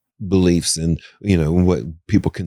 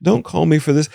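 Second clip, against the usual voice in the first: mock panicked refusal; 7 semitones higher than usual. Retelling refusing a demon house.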